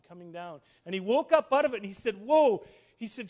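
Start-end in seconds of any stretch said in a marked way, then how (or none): 2.2-2.6 loud, raised, amazed whoa; awestruck Jacob. Acted. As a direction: exclaim as if waking to wonder. Jacob acted waking in amazement.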